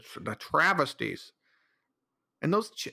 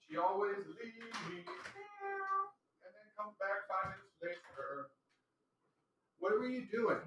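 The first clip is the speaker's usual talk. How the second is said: high, soft cooing voice for the cat; talking to the cat in a silly high voice; 5 semitones higher, 4 dB quieter, slower than usual. Real, not acted. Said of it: "I talk like that 'cause it annoys my girlfriend".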